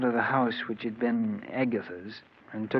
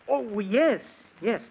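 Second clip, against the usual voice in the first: high, hasty agreement after a stunned pause; covering up surprise; 8 semitones higher, slower than usual. Acted. Husband hiding his ignorance from the investigator, over-eager. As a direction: recover with an over-eager yes.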